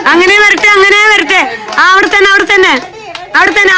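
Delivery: loud, high calls directing the players; calling out loudly. Real, not acted. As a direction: shout directions across the yard. Guiding players in Onam game. (ഉറക്കെ വിളിച്ചു പറയുന്നു)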